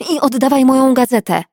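furious vet demanding her magazine back; shout. Acted. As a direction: yell it through the door. Shouting at teasing colleague.

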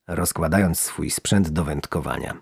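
ordinary delivery

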